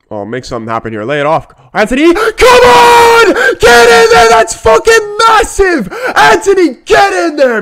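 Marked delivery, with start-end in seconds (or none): 1.7-4.3 yelling at the top of his lungs, much higher and louder; real shout. Real, not acted. Fan watching goal go in. 4.3-5.9 high, loud cry of joy; real shout. Real, not acted. Fan celebrating a goal. 6.1-7.6 much higher and louder yell; real shout. Real, not acted. Fan celebrating a goal.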